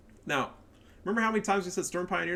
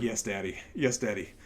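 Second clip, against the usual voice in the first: mock submissive; 4 semitones lower, 4 dB quieter than usual. Mocking Relic submitting.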